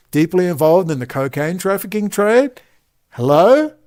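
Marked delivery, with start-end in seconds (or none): 3.1-3.7 louder mock call, rising. Real, not acted. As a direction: call out to get her attention. Calling out to Candace.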